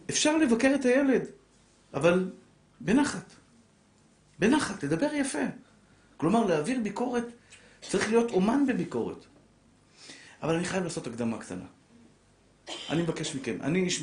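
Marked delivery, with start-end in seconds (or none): 0.1-9.2 much higher and louder, pleading to speak gently; high, loud sermon appeal; impassioned preaching. Acted. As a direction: rise to a fervent peak. Preaching at full force.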